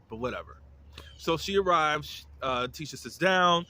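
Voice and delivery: ordinary delivery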